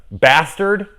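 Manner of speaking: ordinary talk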